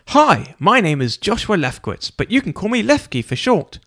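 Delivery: high, friendly introduction with a smile; cheery greeting. A narrator's reading. Lefkowitz greeting his new roommate, high and smiling. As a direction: beam as you introduce yourself. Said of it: "with a big smile to introduce himself".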